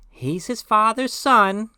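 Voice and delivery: high, loud, slow caricature of a wife; mock wife's voice. Wife remarking on their son, mimicked in high voice. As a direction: do your wife in a put-on high voice. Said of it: "listen to that voice".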